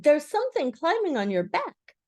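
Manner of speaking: high, urgent warning; crying out. A narrator's reading. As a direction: alert a friend excitedly. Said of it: "he cried".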